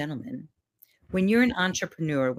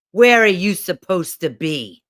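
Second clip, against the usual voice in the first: loud, stern demand; loud, bossy challenge from above; yelling inner critic; 8 dB louder than usual. Acted. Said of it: "this voice yelling at me".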